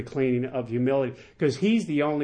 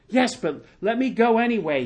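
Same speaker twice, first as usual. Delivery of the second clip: higher, lightly pleading reading; begging; 6 semitones higher than usual. A narrator's reading. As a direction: plead a little, pitch lifted. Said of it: "he begged".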